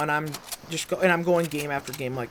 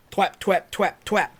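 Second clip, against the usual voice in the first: gun noises; 5 semitones higher, 4 dB louder than usual. Retold or imitated. Imitating his gun firing.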